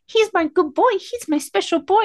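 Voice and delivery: put-on high, cooing cat-lady baby voice; baby talk. Castmate to a photo of her cats, in high baby talk. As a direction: mimic someone gushing over pets in baby talk. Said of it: "like, baby talk to them with you".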